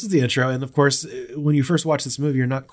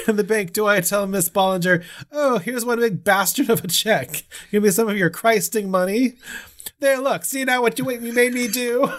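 Annie scolding; 11 semitones higher than usual. Retold or imitated. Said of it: "this Minnesota accent".

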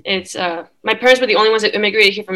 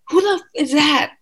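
retold yell; 7 semitones higher than usual. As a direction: call it out high, as if through a door. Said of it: "I yell it at the door".

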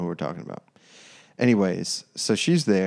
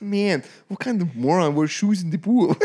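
high, hammed-up mockery; making fun; 9 semitones higher, 4 dB louder than usual. Mocking friend in the pool, hammed-up ridicule. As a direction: jeer at him, pitched way up. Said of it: "my dad was making fun of him".

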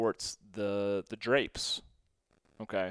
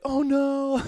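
loud, much higher horrified cry; shocked shout; 12 dB louder than usual. Retold or imitated. High school friends watching the rat get caught, horrified shout. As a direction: yelp it as the snake strikes. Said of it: "we'd be like".

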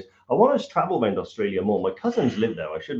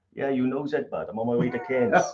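put-on Newport Welsh accent, pitched up; 2 semitones higher than usual. Shown as a demonstration. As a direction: ease into a Newport accent for a demo. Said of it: "do it with a Newport accent".

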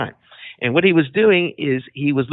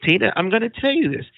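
usual speaking voice

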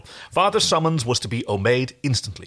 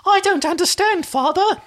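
put-on very high, small child's voice; little orphan child; 5 dB louder than usual. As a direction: play a frightened little boy. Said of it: "make him into a sort of little orphan child".

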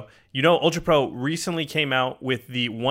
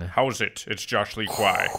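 put-on Sean Connery accent; 3 semitones lower, slower than usual. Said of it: "That actually turned into Sean Connery".

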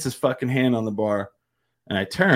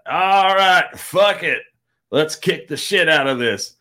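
imitated rallying cry; 4 semitones higher than usual. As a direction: holler like a drunk charging back in. Singer returns, rallying for the stage.

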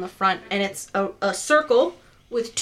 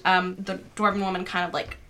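ordinary delivery

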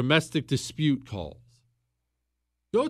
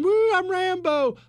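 much higher and louder mock whoop, "Woo, I'm Rambo"; mock action hero; 4 dB louder than usual. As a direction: ham up a reckless gun nut playing Rambo. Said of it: "you don't just run out and start shooting".

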